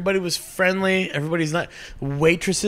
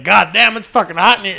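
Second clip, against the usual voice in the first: loud, higher mock complaint; farm kid exclaiming; 5 semitones higher, 7 dB louder than usual. Farm kids taking shirts off, loud complaint. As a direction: call it out like an overheated farmhand.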